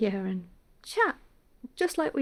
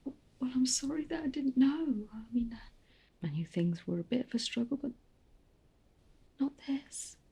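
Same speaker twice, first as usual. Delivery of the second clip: soft whispered regret; grieving whisper; 4 semitones higher, 5 dB quieter than usual. Acted. Grieving mother's apology.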